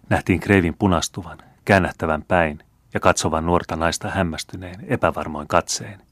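ordinary delivery, nothing marked

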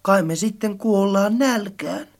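low, soft, forlorn question; tearful whimper. A narrator's reading. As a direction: ask it low, with a touch of a sob. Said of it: "Möttönen nyyhkäisi".

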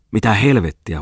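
sudden cry of disbelief; startled exclamation. A narrator's reading. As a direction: blurt it out in alarm. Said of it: "huudahti toimittaja".

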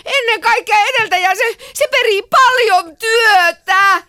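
high, loud, crying wail; desperate housewife. Pretend. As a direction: sob the speech out like a desperate housewife at her wits' end. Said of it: "lukisit täysin epätoivoisena kotiäitinä".

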